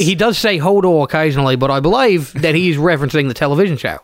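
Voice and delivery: broad mock Aussie; hammed-up Aussie bloke; Australian accent. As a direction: lay on a thick Australian accent. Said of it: "doing Australian accents".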